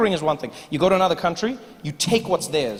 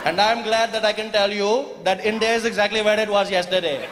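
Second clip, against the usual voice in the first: put-on Indian accent; 2 semitones higher than usual. Indian farmer answering calmly.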